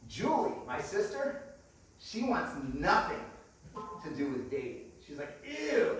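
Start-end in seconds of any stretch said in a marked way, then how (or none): 5.4-6.0 high-pitched, squeamish ew; mock disgust. Imitating little sister grossed out by dating, mock disgust.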